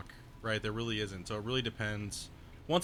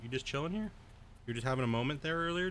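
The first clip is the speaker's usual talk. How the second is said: normal talking voice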